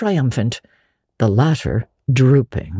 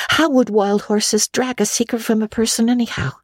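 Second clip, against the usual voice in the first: put-on higher girlish voice, wistful; a lonely girl making the best of it; young Anne; 8 semitones higher than usual. A narrator's reading. Anne's voice.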